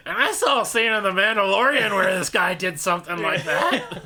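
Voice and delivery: high, booming mock storytelling; loud oblivious guy. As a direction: blare it out as the oblivious loudmouth. Imitating loud guy in the booth.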